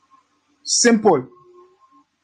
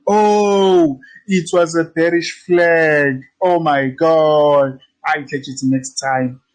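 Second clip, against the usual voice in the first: mock realization; slower than usual. Students seeing the bearish flag too late, drawn-out mock regret. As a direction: drag out the words like a rueful latecomer. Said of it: "some of you may be like".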